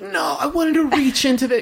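hammed-up high girly protest; mock Carly. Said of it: "Carly said something along the same lines".

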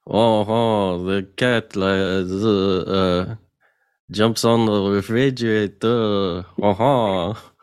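hammed-up French accent with a breathy "oh, oh". Shown as a demonstration. Showing a friend a French accent to imitate, hammed-up French. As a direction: play it like a cartoon Frenchman, lightly. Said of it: "you wanna give me an example of French accent".

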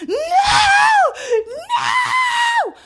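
high shrieked no, no; retold screaming no. Neighbor's screams retold as a high shout. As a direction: shriek it like a woman in terror. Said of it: "It was screaming, like".

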